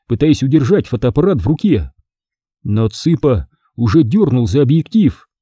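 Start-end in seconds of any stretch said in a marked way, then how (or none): none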